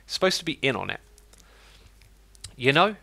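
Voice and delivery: usual speaking voice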